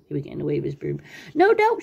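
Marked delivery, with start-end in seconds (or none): 1.3-1.8 high, loud cry of alarm; panicked shout. A narrator's reading. Sylvester begging the witch to stop, panicked shout. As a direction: shout it high as you bolt. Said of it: "shouted Sylvester".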